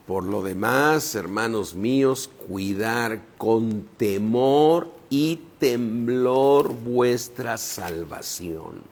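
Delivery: slow, drawn-out low reading; solemn scripture reading. Acted. Preacher reading a verse to the congregation, solemn and slow. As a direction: weigh each phrase slowly, pitched low.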